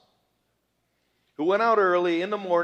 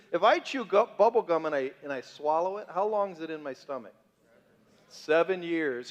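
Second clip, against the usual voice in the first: ordinary talk, nothing marked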